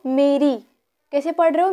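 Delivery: ordinary talk, nothing marked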